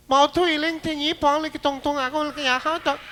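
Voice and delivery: high, fast fake Chinese gibberish; mock Chinese gibberish. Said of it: "the Chinese man reading the direction".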